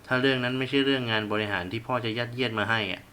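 ordinary delivery, nothing marked

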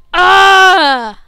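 loud, high raging yell; furious scream. Real, not acted. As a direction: let out a real rage scream. Rage after losing.

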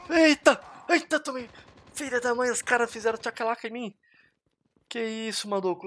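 high-pitched shocked complaint; startled yelp. Real, not acted. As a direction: react as if ambushed mid-game. Gets shot by surprise.

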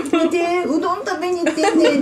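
mock friend quote. Mother mimics a friend's words.

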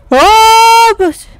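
loud high gasp of fright; startled cry. Real, not acted. Still shaken after the scare, crying out.

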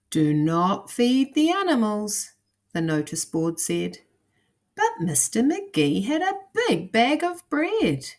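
put-on lively storybook narration for kids; reading to children. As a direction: read it like a bedtime picture book. Reads picture book to children.

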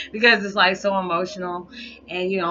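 fighting back tears. Real, not acted. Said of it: "I'm trying not to cry".

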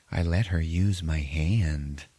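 put-on slow, measured creepy-child answer; lightly imitated spooky kid; eerie little girl. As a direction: draw it out like an uncanny child.

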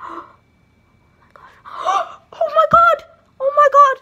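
gasping pretend fright; hammed-up scared gasps; mock panic. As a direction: gasp and panic, half laughing. Reacts to scary call.

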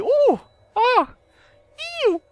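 high squeals of delight; swooning women. Retold or imitated. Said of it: "и такие".

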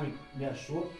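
normal talking voice

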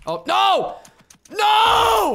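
high, loud cry of disbelief; anguished shout. Real, not acted. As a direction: yell out as the game goes wrong. Loses his bed break attempt.

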